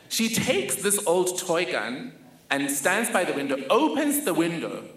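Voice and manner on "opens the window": normal talking voice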